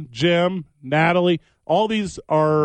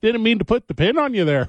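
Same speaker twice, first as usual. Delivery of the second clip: high, apologetic mock-Canadian; hammed-up Canadian politeness; Canadian accent; 7 semitones higher, faster than usual. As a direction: play an overly sorry Canadian wrestler. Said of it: "you got a Canadian accent".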